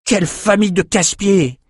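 high, exasperated exclamation; very irritated outcry. A narrator's reading. Young swordsman back from the quays, very irritated. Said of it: "s'écria le jeune escrimeur très énervé".

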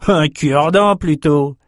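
jeering sailor; mocking. A narrator's reading. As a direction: make fun of the weapon. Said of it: "railla un matelot".

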